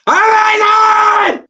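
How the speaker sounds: high, drawn-out shout of joy; excited shout. Real, not acted. Fan reliving the late winner, ecstatic drawn-out shout. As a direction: yell it like the winner just went in. Celebrating last-minute goal.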